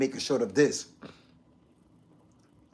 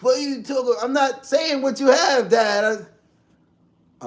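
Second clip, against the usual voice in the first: much higher, louder put-on voice imitating dad; mocking dad; 9 semitones higher, 9 dB louder, slower than usual. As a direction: ham up a nosy father listening in, high and loud. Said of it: "he might be watching like".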